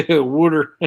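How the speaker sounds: high, loud teasing Pennsylvania wudder; mock Pennsylvania twang. Teasing her friend with a mock Pennsylvania twang. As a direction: poke fun at his Pennsylvania water. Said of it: "Pennsylvania twang".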